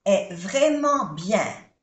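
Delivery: "ce film est vraiment bien" slow, stress on "vraiment"; slow model. Shown as a demonstration. Teacher modelling French example sentence slowly. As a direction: lean on "vraiment". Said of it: "En francés".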